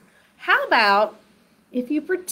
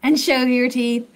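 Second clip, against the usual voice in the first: ordinary delivery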